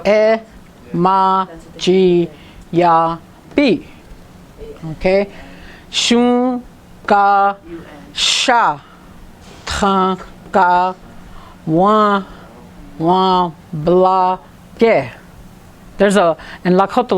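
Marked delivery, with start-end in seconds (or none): 0.1-3.8 "E, ma, ji, ya, bi" each syllable separate; teacher splitting into syllables. Shown as a demonstration. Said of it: "break it up into syllables, just like this". 5.9-15.1 "Shun, ga, sha, tan, ga, wan, wan, bla, ge" split apart; teacher spelling out syllables. Shown as a demonstration. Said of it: "just like this".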